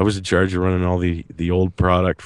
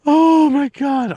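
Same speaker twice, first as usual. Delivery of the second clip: high, loud, drawn-out oh my God; excited outburst; 6 dB louder, much slower than usual. Real, not acted. Co-host thrilled by the segment idea, loud excited cry. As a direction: burst out in delight, stretching every word.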